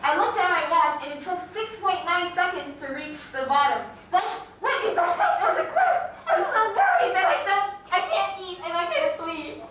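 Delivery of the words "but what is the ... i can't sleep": high, laughing fake worry; worried cartoon character. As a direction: ham up the anxious plea. Skit character worried about problem.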